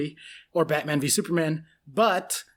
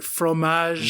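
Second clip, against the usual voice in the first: French accent. Shown as a demonstration. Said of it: "Can you say it the French way?".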